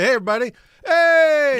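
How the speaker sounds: high, booming hail to a crowd; loud greeting. Retold or imitated. Actor retelling his walk into the room, shouted hello. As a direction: call out to everybody, rising into a cheer. Acting out greeting the whole set.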